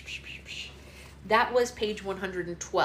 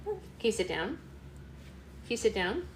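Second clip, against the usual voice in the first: usual speaking voice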